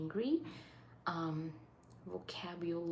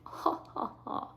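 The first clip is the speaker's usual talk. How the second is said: laugh; 11 semitones higher than usual. Real, not acted. Laughing at a viewer comment.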